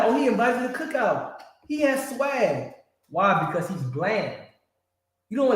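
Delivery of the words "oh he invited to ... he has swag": mock admiration. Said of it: "we like".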